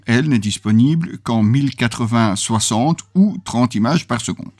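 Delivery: ordinary talk, nothing marked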